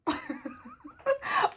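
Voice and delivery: high giggling burst; high laugh. Real, not acted. Vlogger feeling spaced out, high giggle. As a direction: crack up at yourself.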